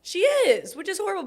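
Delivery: excited. Real, not acted. Excited reaction to crying prediction.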